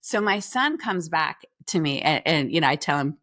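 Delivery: usual speaking voice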